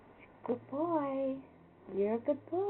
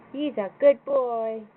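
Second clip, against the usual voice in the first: excited praise; 9 dB louder than usual. Real, not acted. Loud praise to the dog.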